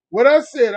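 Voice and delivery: What I said hit loud; loud emphasis on What I said; emphasized. Shown as a demonstration. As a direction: punch What I said. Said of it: "I emphasize".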